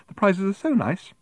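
high, prim gushing; prim smile. A narrator's reading. Woman selling raffle prizes, primly gushing. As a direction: gush through a fixed smile. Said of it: "she said with a smile".

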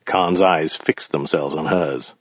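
ordinary delivery, nothing marked

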